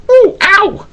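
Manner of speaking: put-on high squealing child cry; little boy's squeaky yelp. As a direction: yelp it like a hurt little boy. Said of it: "cried the little boy".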